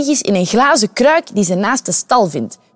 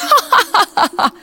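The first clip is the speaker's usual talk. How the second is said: high burst of mocking laughter; 9 semitones higher than usual. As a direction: laugh it off at the woman. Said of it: "En hij lacht het uit". (hoge, spottende lach)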